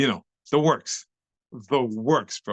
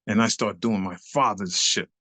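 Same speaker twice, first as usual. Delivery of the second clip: ordinary delivery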